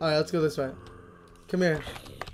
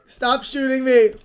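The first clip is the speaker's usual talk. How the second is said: ordinary delivery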